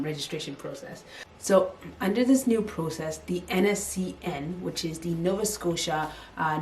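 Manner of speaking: ordinary talk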